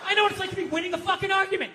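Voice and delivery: ordinary talk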